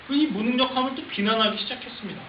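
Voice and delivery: usual speaking voice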